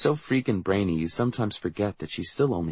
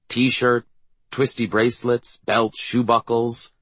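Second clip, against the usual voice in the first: ordinary delivery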